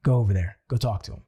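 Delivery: a deep inner voice ordering him forward; bold inner voice. Retold or imitated. The bold inner voice urging him to approach, low and firm. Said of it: "and that voice said".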